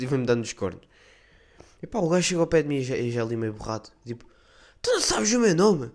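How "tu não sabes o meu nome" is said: indignant acquaintance. Retold or imitated. Guy confronts him about name.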